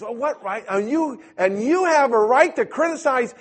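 high, loud, indignant mock outrage; retold angry outburst. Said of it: "And then he starts getting mad at me".

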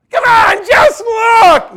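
very high, loud pleading yell; desperate shout. Acted. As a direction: scream at him to just look. Acted plea to the snake-bitten.

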